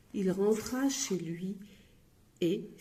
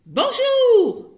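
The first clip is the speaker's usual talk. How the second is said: much higher, loud yelled hello; yelled greeting; 13 dB louder than usual. Acted. Grocer yelling a greeting at Monsieur Silence. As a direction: holler it at the customer. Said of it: "hurlait l'épicerie".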